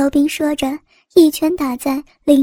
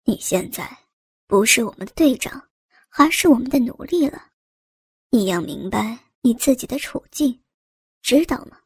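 cold threat, pitched down; menacing; 2 semitones lower, 3 dB quieter than usual. A narrator's reading. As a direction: lay down the law softly and viciously. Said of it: "高斌恶狠狠地说道".

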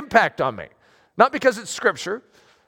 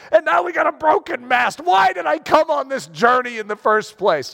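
mock panic; 7 semitones higher than usual. Role-playing seasick pilgrim despair.

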